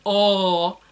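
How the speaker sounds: exaggerated "Oh", an A pushed way back in the throat. Teacher mocking Americans' idea of English A, throaty exaggerated "Oh". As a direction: hold a long, open vowel far back in the throat. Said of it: "You always think our A sounds are like".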